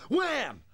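high, loud wham; punch sound effect. Retold or imitated. Teller acting out his punch on Richie, high loud wham. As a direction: bang it out like the punch landing.

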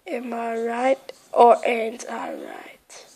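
slow hushed whisper. Real, not acted. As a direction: whisper to yourself. Whispering while hiding.

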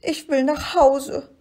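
high, whimpering little creature; schluchzend. A narrator's reading. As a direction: play it tearful and homesick, pitched high. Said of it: "hörte er es schluchzen". (hohe, weinerliche Monsterstimme)

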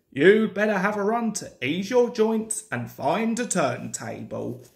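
ordinary delivery, nothing marked

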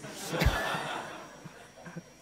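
breathy laugh; real laughter. Real, not acted. Speaker laughing at his own story. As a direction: laugh at the memory.

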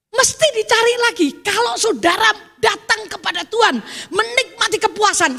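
loud, high insistent preaching; emphatic pulpit declaration; fervent preaching. Acted. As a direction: press the point home hard.

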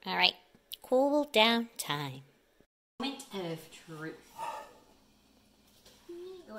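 normal talking voice

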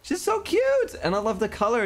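very high, adoring squeal; delighted high-pitched gushing. Real, not acted. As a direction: coo over something too cute. Gushing over Audie.

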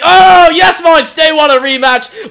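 loud, high burst of joy; delighted exclamation. Real, not acted. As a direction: burst out thrilled at the news. Delighted at getting a rematch.

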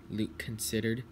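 ordinary delivery, nothing marked

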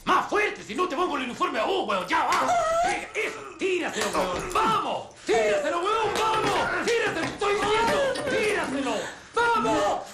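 very high-pitched furious yelling; frenzied screamed orders. Acted. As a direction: scream commands relentlessly, voice at the top. Ordena frenético al que se niega. (órdenes frenéticas a grito pelado)

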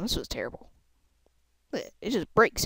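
ordinary delivery

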